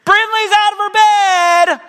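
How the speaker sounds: put-on a little boy tattling at the top of his voice downstairs; mock child shouting out a tattle; child yelling. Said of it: "So Charlie yells to us downstairs".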